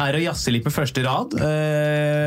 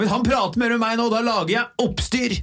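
high, fast mock outrage; furious complaint; 9 semitones higher than usual. Angry audience member threatening the organiser, mock fury. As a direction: spit out the threat, hammed up. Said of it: "en rasende melding".

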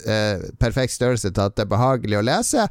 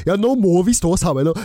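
Oslo dialect, higher and eager; enthusiastic Oslo dialect; 6 semitones higher, 3 dB louder than usual. Shown as a demonstration. Host shows how he talks, in enthusiastic Oslo dialect. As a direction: put on a keen Oslo accent. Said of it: "veldig entusiastisk Oslo-dialekt".

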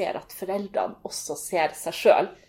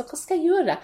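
exasperated mother; 5 semitones higher, 4 dB louder than usual. Retold or imitated. Mother's exasperated plea voiced.

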